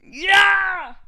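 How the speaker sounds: loud high burst of cheer; mock cheer. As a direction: shout it like an overeager fan. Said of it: "someone's like".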